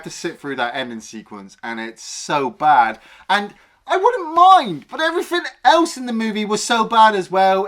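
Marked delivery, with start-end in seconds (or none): 3.3-7.6 high, loud comic outburst; exasperated rant. Real, not acted. Ranting to co-host about how bad everything was, exasperated.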